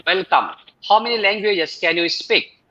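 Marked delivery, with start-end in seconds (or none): none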